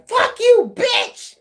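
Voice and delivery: high, loud hammed-up shriek; mock mad scream. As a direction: screech it like someone enraged. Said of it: "somebody so mad at your ass".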